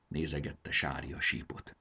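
usual speaking voice

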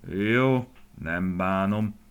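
slow, drawn-out giving in; smiling consent. A narrator's reading. Grandfather yielding to his grandson, slow smiling consent. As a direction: give in indulgently, stretching the words. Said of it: "mosolyodott el a nagypapa".

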